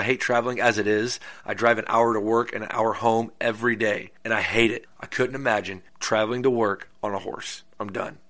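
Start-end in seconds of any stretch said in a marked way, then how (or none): none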